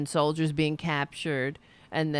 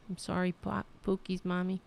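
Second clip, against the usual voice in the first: ordinary talk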